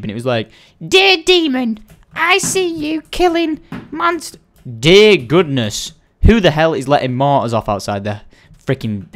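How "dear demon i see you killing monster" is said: high, put-on reading of a comment; imitated commenter. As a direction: read it out in a silly high voice. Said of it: "I saw a hilarious comment".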